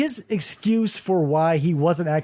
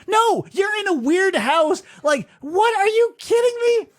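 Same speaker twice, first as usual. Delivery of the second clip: incredulous shout; 11 semitones higher than usual. Real, not acted. Ranting at the absurd scene.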